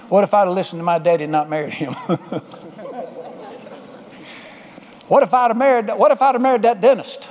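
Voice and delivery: mock wistful what-ifs with a laugh; regretful wife. Preacher imitating a regretful wife dwelling on the past, mock wistful. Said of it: "cause you to look back and say".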